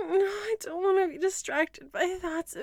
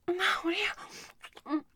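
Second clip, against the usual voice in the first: whisper; 6 dB quieter than usual. Acted. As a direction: hush every word, spent. Whispers through aftermath.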